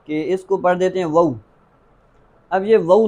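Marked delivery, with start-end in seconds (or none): 1.1-1.3 "وو" as a short vow, without the long alif; "وو" said as a quick diphthong; mispronounced waw. Shown as a demonstration. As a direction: show the mistake, cutting the vowel of "وو". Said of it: "اس حرف کو غلط پڑھ دیتے ہیں".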